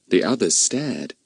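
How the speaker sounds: ordinary delivery, nothing marked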